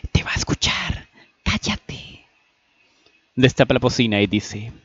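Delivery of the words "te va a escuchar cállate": whispered warning to a friend; hushed, voiceless scolding; urgent whisper. Acted. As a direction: whisper so the caller won't hear. Hushes Annie, mouthpiece covered.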